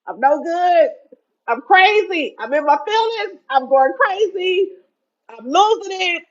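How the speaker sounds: high, loud, overwrought wailing; mock meltdown over a new man; hammed-up frenzy. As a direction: play yourself going to pieces. Acting out losing control.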